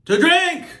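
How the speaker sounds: high, loud shout of a catchphrase; sudden loud call to the audience; exclaimed sign-off. Acted. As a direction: belt it out far above normal pitch.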